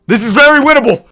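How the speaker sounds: high, loud insistence; excited shout. Real, not acted. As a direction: shout it with all your hope. Repeats it shouted, awaiting result.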